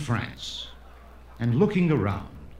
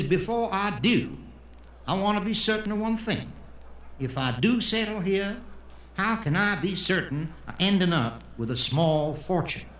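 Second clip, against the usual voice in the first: put-on higher-pitched Texan accent; 5 semitones higher than usual. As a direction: sound like a Texan weighing a business deal. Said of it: "the Texan who comes to Israel".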